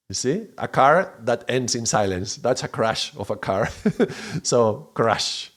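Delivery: ordinary talk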